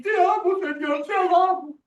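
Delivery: mock the liar shouting his love; hypocrite's emotional shout. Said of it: "Tú mientes, hablas chisme".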